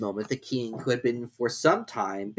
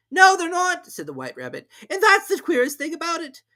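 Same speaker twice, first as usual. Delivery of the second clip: high, loud hurried rabbit; 7 dB louder than usual. A narrator's reading. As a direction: rush it as the rabbit.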